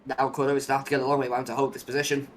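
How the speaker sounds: lively live play-by-play call; motorsport commentator. Acted. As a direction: call the overtake as it happens.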